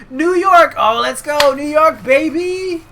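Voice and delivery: high, loud hammed-up whoop; acted burst of excitement; excited cheer. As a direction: shout it like a hyped-up wrestler. Voicing excited wrestler heading to New York.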